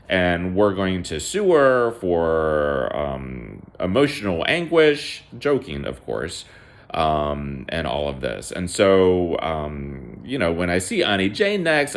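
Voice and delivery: plain reading voice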